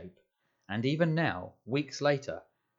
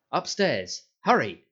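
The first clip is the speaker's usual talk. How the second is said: louder, higher barked command; sharp order; 4 semitones higher, 7 dB louder, slower than usual. A narrator's reading. Aunt Petunia sending Harry upstairs before guests, sharp and loud. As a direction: shoo him off at once.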